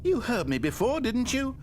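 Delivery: game narrator pleading. Acted.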